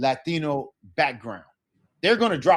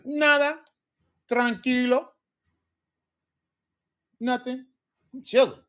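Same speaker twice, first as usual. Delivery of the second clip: high, breezy nada tranquilo; laid-back answer; 9 semitones higher than usual. Shown as a demonstration. Teacher modeling a relaxed reply, high and breezy. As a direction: toss it off easy and relaxed. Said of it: "Or I can say".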